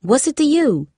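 high, defensive retort; challenging. Acted. Girl snapping back at a nosy classmate, challenging. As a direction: push her away with the question. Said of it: "Darcy challenged".

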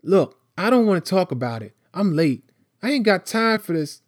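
put-on high, hurried boy's voice, brushing it off; Tyree, agitated. Late boy refusing to talk, agitated. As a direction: rush it, pitched well up, wanting out.

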